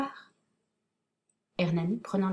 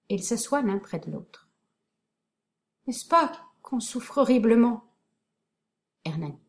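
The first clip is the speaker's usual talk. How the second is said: plain reading voice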